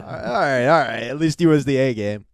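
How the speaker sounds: big hollered group approval; loud, high comic acceptance; mock concession. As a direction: shout it as a grudging mock cheer. Shouted prisoners' reply in riff.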